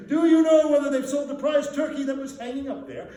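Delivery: loud, high, laughing eagerness; eager laughing question. Acted. Scrooge eagerly asking the boy about the turkey, laughing. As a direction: ask it bubbling over with glee.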